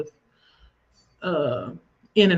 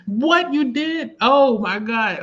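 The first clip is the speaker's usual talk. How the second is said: high, drawn-out disbelief; real astonishment; 6 semitones higher, 4 dB louder, much slower than usual. Real, not acted. Host reacts to a viewer's comment, astonished. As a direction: stretch it out high in shock.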